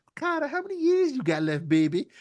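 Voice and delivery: high put-on teasing woman's voice; mock flirty Sasha. Host voicing Sasha flirting with Okada, high and teasing. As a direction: tease him in a high sultry voice. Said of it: "she gonna be like".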